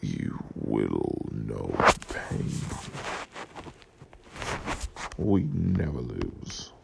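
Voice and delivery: very low, slow, deep menace; deep, slow ominous villain voice; menacing villain. Pretend. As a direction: growl it like a movie villain.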